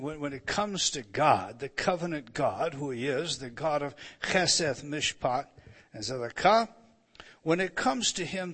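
normal talking voice

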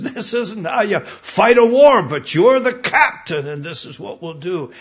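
mock soldier's loud protest; exasperated protest. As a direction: push back hard on the order. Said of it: "And he says".

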